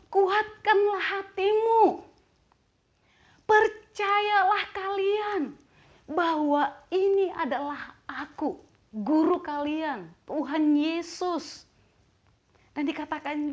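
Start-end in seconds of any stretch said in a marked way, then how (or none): 0.1-11.6 slow, high proclamation to the disciples; Jesus. Acted. Jesus encouraging his disciples, slow raised proclamation. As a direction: draw out each phrase in a raised voice. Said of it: "Yesus berkata kepada mereka".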